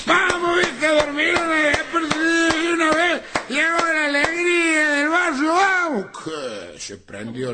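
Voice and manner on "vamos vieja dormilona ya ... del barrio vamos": put-on high, shouted drunken call in the street; drunk shouting. Drunk friend yelling at the house at four a.m., high shout. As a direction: holler up at the house like a happy drunk. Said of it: "a lo grito".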